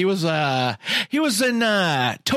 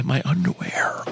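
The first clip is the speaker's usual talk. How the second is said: whispered aside; hushed, breathy secret; 4 semitones lower than usual. Real, not acted.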